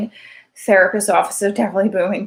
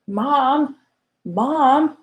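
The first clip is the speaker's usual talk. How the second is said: put-on child calling mom; 6 semitones higher than usual. Daughter calling her mother.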